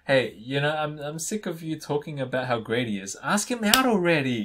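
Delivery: ordinary delivery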